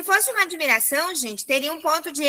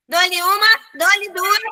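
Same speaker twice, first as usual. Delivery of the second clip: higher, louder auctioneer-style call; mock auctioneer countdown; 5 semitones higher, 4 dB louder, slower than usual. Teacher hurrying students to answer, mock auctioneer countdown.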